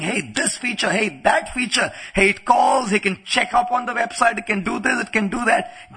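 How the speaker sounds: high, eager mock gadget chatter; excited hype. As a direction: rattle off features like an imitated enthusiast. Imitating people hyping a gadget.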